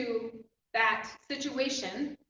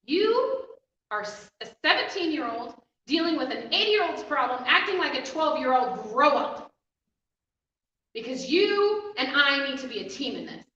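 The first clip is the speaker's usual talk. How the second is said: stern, firm mom voice, louder; mother telling her daughter to grow up, harsh and stern; harsh scolding; 2 semitones higher, 7 dB louder than usual. Retold or imitated. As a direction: scold a teenager who needs to grow up. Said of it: "I say it a little more harsh than my mom does".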